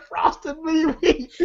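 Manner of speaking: slow, laughing through "Frosted mini wheat". Retold or imitated.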